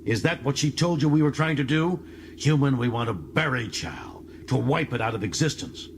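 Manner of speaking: snarling Klingon turning on the human. Acted. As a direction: bite out the words in contempt. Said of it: "Torl actually snarled".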